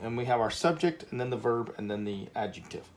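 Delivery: ordinary talk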